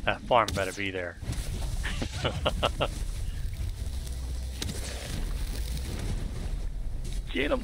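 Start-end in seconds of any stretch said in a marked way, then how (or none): 1.2-7.3 long breathy laugh. Real, not acted. Long laugh during play. 7.3-7.6 high excited call; quick high cry to attack. Real, not acted. Urging teammate in fight.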